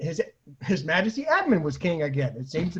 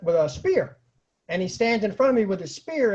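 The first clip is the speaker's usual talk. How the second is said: ordinary talk, nothing marked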